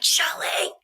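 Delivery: a hushed desperate call; whispered call. Retold or imitated. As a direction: whisper her name urgently. Calling Shelly from the water.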